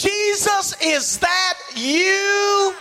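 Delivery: reach out across the storm to him; joyful call. Acted. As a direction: Peter calling out in wonder. Peter recognizes Jesus, calling.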